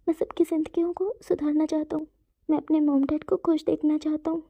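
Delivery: high, tear-choked voice with whispered breaks; crying as he says what he wants for everyone; crying plea. Acted. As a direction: plead through tears, helpless. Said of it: "आंखों से गिरते हुए आंसुओं को पोंछता".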